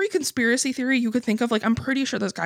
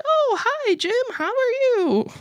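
much higher, sing-song fake-sweet hello; fake polite greeting; 14 semitones higher than usual. Greeting the neighbor Jim, much higher fake-sweet voice. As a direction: chirp it like forced small talk.

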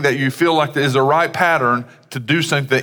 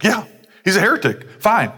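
mock dismissive crowd; 9 semitones higher than usual. Mocking crowd's dismissive reply.

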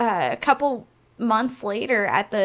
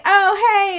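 high, loud oh hey; bright greeting; 11 semitones higher, 8 dB louder than usual. Retold or imitated. Performer greets a board member in a high loud voice. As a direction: greet her with fake brightness.

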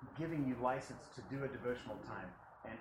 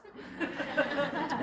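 high laugh; 6 dB louder than usual. Real, not acted. Laughs at his joke.

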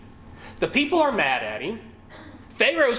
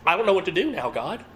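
usual speaking voice

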